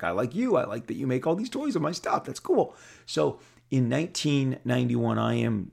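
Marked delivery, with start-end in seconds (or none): 0.0-2.7 rising higher to an excited, higher finish; excited reply. Retold or imitated. Artist answering the toy boss, rising giddy voice. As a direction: play back the compliment, getting giddy. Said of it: "And I'm like".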